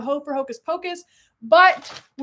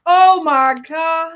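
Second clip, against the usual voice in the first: amazed; 2 semitones higher, 10 dB louder, much slower than usual. Real, not acted. Excited reaction to candy find.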